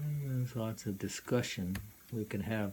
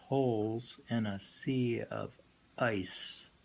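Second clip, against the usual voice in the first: slow, raised opening, then settling into steady reading; poem recital; 2 semitones higher, 4 dB louder, slower than usual. Acted. Lecturer rereading the poem to the class, slow and grand. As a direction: recite it with ceremony.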